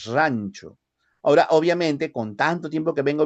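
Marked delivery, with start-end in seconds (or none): 0.1-0.8 "Rancho" with a rolled highland r; sierra-style double R. Shown as a demonstration. Teacher gives one more sierra R example. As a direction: demo "Rancho" with the old sierra r. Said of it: "así, ¿ya?".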